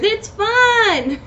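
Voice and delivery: high excited burst. Real, not acted. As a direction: blurt it out with glee. Bursts out after "who cares.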